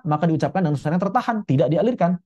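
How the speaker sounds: ordinary delivery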